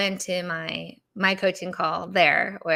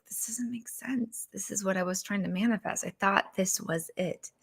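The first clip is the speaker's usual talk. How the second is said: despairing whisper; 8 dB quieter than usual. Retold or imitated. Speaker retelling her thoughts after the failed livestream, whispered. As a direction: breathe the words out in disbelief.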